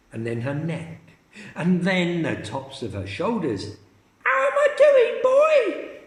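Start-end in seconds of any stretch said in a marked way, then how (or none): 4.2-6.0 put-on very high, loud gleeful old woman; shout. Grandma shouting from the roof, very high and loud. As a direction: crow down to the boy. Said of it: "she shouted".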